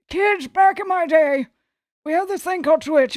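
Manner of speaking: put-on creaky grandparent lecturing the kids; old-timer voice. Streamer mocking her future self as an old-timer. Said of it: "you're gonna hear me talk about".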